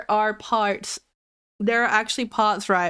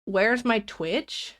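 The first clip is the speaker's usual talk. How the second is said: slow drawn-out question; much slower than usual. Retold or imitated. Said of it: "Say what?".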